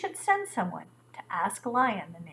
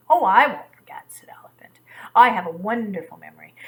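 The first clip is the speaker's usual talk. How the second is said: boastful elephant; 10 dB louder than usual. A narrator's reading.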